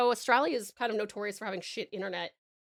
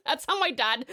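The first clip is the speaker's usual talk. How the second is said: ordinary talk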